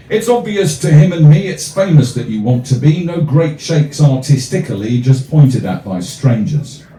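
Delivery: measured rhythmic poem reading; poem recital. Acted. Comic poet reading his verse to the crowd, rhythmic recital. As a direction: recite it with a steady, rhyming lilt.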